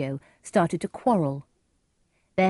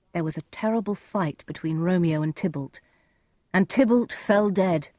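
ordinary delivery

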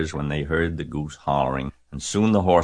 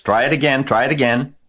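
high, loud urging; goose crying out; 8 semitones higher, 7 dB louder, faster than usual. A narrator's reading. Goose cheers Wilbur on, crying out. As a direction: egg him on, voice pitched up. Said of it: "cried the goose".

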